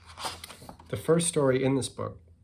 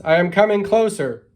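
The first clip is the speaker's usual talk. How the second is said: high, full-voiced storybook shout; calling out loudly; 10 semitones higher, 14 dB louder than usual. A narrator's reading. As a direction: yell it across the gap. Turtle calls while approaching.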